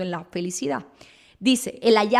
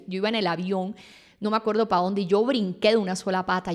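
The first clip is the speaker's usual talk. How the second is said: ordinary talk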